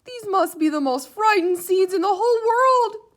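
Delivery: high, loud despairing lament; crying out. A narrator's reading. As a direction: wail over the frightened seeds. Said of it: "cried Toad".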